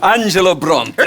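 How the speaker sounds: high, loud laughing welcome; laughing delight. Acted. Boss delighted by the outlaw, laughing loudly. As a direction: laugh through the words with glee.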